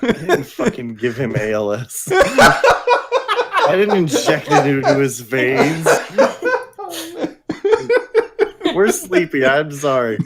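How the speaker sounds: talking through laughter; words breaking into giggles; laughing. Real, not acted. Cracking up after a joke.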